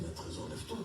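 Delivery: hushed, under the breath; whispered aside. Retold or imitated. To Trésor beside him in church, hushed whisper. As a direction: murmur so the pastor won't hear.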